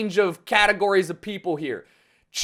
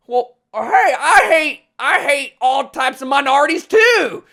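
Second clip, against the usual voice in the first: higher, louder mock yokel voter; bigoted voter voice; 5 semitones higher, 6 dB louder than usual. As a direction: ham up a casual bigot. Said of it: "are like".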